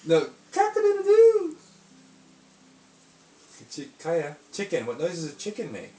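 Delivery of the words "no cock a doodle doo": high, loud cock-a-doodle-doo; loud rooster crow. Shown as a demonstration. Showing rooster call to toddler.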